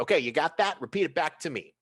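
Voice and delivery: loud, high bossy yell; yelling at imp. Acted. Boss yelling orders at his tired imp, high and loud.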